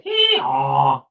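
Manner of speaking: high braying hee haw; donkey bray. A narrator's reading. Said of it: "The donkey said".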